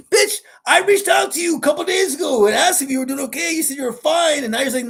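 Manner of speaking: high, loud angry rant; pissed-off outburst. Acted. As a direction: yell it at a friend who ignored you. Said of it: "I get pissed 'cause it's like".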